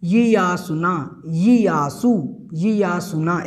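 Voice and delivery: यियासुना said slowly, yi-yaa-su-naa; slow pronunciation drill. Shown as a demonstration. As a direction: repeat यियासुना deliberately, syllable by syllable. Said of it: "एक दो बार आप बोलेंगे तो आपका उच्चारण बन जाएगा". (यियासुना का धीमा अभ्यास)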